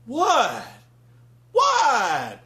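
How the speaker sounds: loud, high drawn-out mock yelp; hammed-up innocent outcry; feigned shock. Loki reacting to being suspected, feigned shock.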